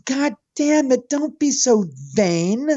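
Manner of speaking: playful mock anger at the co-hosts; mock exasperation. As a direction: scold your friends in jest.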